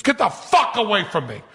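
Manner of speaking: retold outburst, high and shouted; furious yell. Dave yelling at the magician covered in cockroaches, furious. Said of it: "He goes".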